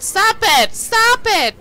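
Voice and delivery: frantic plea to make it stop; being chased. Real, not acted. Chased, pleading with game.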